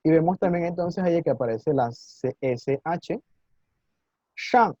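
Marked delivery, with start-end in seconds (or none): 4.4-4.7 "shang" with the tongue curled up to the palate, said higher and falling; "shang" with a raised, curled-back sh; retroflex sh. Shown as a demonstration. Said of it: "con la lengua arriba en el paladar".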